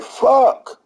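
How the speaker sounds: high squeaky fuck; mock Tim curse. Tim reacting to the haircut, high squeaky curse. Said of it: "Tim Thomas face like".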